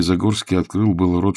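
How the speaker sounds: ordinary delivery, nothing marked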